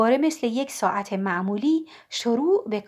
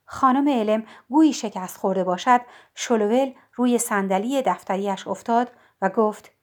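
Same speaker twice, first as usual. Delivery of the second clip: usual speaking voice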